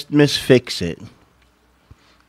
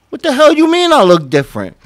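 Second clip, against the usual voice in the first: irritated husband; 8 semitones higher, 5 dB louder, faster than usual. Acted. Husband snapping back in skit.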